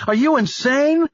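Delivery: very high, loud incredulous cry; Annabelle screaming. A narrator's reading. Annabelle screams at Stone for sending his men, high and loud. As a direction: scream it as if he has lost his mind. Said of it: "she screamed into the phone".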